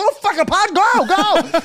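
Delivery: high, frantic shouting; panicked yell. Retold or imitated. Yelling at driver stuck in intersection, high and frantic. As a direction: yell urgently to get them moving.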